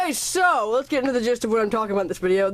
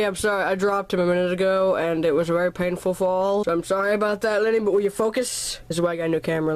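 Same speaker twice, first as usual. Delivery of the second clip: ordinary talk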